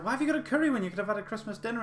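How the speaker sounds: high, fast, incredulous imitation; mock disbelief. Servery staff querying a curry order at Christmas, mock incredulity. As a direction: put on a high-pitched, baffled voice and rush it.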